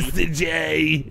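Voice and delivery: slow drawl, cigarette drag; smoky Harley voice. Retold or imitated. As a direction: drawl it after a long drag. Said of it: "nice long drag on her cigarette".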